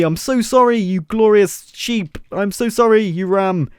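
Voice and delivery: playfully desperate sorry; mock apology. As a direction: beg the angry animal's pardon. Pleading with the angry ram.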